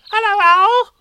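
very high, loud squeak; squeaky Roo. Acted. Roo squeaking a greeting to Pooh at the party, very high and loud. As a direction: squeak it like an excited baby kangaroo. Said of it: "So he said".

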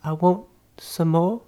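put-on high, slow English child's voice; small high British boy, halting; pleading orphan boy. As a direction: repeat the plea as a meek little orphan. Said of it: "And he's like".

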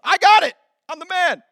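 loud, high macho brag; cocky boast. Retold or imitated. Husband refuses the wife's GPS with a loud, high cocky brag. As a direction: puff up and crow it. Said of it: "That's what I said to her".

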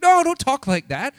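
high, loud, drawn-out objection; imitated disciple's pleading outburst; acted protest. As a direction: cry out in mock dismay. Acting out Peter protesting to Jesus.

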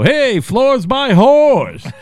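high, loud, slow sing-song jeer; taunting greeting. Retold or imitated. Scott teasing the floor guy at the door, loud, high jeer. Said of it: "Scott would go".

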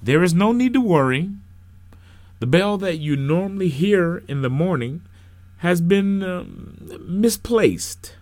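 high, projected pulpit voice with a hesitation; loud preacher, awkwardly pausing; minister addressing congregation. Acted. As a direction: reassure the crowd, then falter before the bad news. Minister reassures, hesitates over news.

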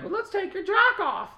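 put-on high, slow girly voice; coy girly come-on; girl voice. Quoting the girls in video.

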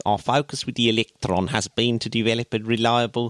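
put-on a rough stab at a Kiwi accent; New Zealand accent. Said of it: "That is so South African".

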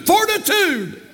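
high, drawn-out FORTITUDE; preacher's cry. Real, not acted. Preacher to church on endurance, rousing cry. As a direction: cry the word out high and long. Preacher cries out, then repeats quietly.

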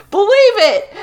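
high, laughing mock catchphrase; hammed-up anime shout; Naruto catchphrase. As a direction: quote the anime hero. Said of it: "I'm watching Naruto now".

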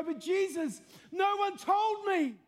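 high, drawn-out acted plea; pleading protest. Man standing before Jesus making his excuse, high pleading protest. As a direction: wail it as a desperate excuse.

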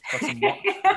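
breaking into a laugh mid-word. Real, not acted. Winemaker laughing at his empty bottle to the host, laughing. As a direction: giggle through the word.

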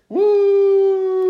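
long, high, loud whoop; triumphant whoop. Real, not acted. Cheering after the fact check proves him right, triumphant whoop. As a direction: let out a drawn-out victory woo.

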